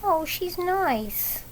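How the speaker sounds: put-on high, slow, soft little girl. Debbie defending the cat, high slow little girl voice. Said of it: "But Debbie said".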